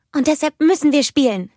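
put-on high, loud child's voice; little sister coaxing big sister to play; eager little girl. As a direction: plead like an excited small child. Little Anna begging her sister.

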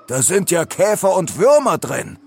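high-pitched revolted outcry; horrified. A narrator's reading. Leo finds bugs in his cake, horrified outcry. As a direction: exclaim it with rising revulsion. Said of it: "Entsetzt deutete er auf das Innere".

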